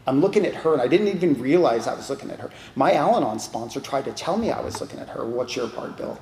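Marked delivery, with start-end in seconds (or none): none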